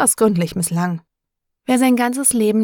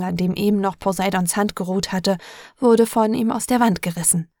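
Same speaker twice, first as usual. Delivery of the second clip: ordinary delivery, nothing marked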